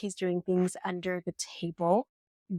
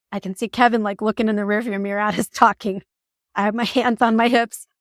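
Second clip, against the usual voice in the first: ordinary talk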